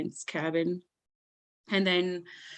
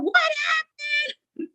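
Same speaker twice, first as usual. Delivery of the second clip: granny freaking out; much slower than usual. Retold or imitated. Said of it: "my granny's freaking out".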